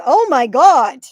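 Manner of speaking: loud, astonished outburst; loud real surprise; real shock. Real, not acted. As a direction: blurt it out, stunned by a gift. Sudden generous gift from a viewer.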